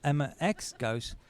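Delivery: ordinary talk, nothing marked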